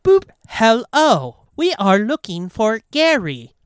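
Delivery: slow, stiff robocall voice; robot voice. Retold or imitated. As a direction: speak like an automated caller. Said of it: "the fucking robot voice".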